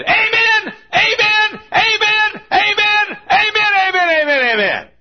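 high, loud repeated amen; little voice shouting amen. Retold or imitated. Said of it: "a little voice said".

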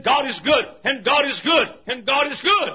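loud, high God is good; rising shout of praise. Retold or imitated. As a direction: call it out like praise. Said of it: "he kept on and saying".

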